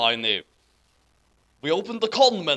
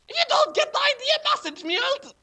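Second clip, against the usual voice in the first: high, fast mocking squeak of the fic author; 4 dB louder, faster than usual. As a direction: parody the author's note in a squeaky voice. Reading fanfic author's note mockingly.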